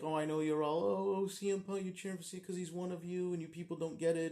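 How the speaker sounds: high mocking sing-song, mimicking the fans; lightly mimicking whiny Chicago fans; mocking the crowd. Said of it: "So I had to say, like".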